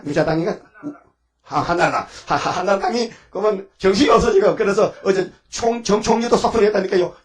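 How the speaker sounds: usual speaking voice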